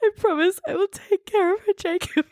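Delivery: high, choked-up reading through real tears; reading the note while welling up; teary reading. Real, not acted. Tearing up reading Edward's note to a co-host. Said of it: "I've got tears in both eyes".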